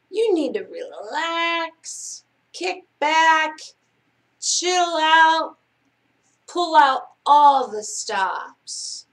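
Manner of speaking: high, drawn-out coaxing, phrase by phrase. A narrator's reading. The cat urging uptight kids to relax, coaxing.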